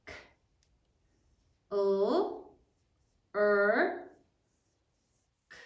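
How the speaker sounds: K, U, R, K with long gaps between sounds; sounded out while writing. Shown as a demonstration. Teacher modelling spelling for pupils, K, U, R, K sound by sound. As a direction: say each sound at writing pace: K, U, R, K. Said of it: "say it as you write it".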